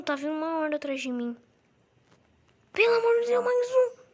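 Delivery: high, loud panicked shouting; panicking gamer. Real, not acted. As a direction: scream for help as the horde closes in. Zombie horde chasing him. (gritos de pânico agudos)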